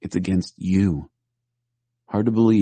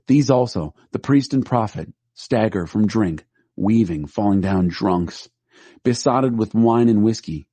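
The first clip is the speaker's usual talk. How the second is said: ordinary delivery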